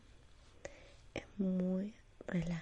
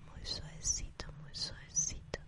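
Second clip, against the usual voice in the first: sleep story narrator; 3 semitones lower than usual. Acted. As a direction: lull them to sleep.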